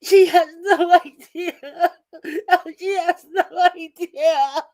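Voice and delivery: high, slow sing-song gloat with laughter. Real, not acted. Man gloating over the woman caught on video, laughing sing-song. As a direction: laugh it out mockingly, drawn out.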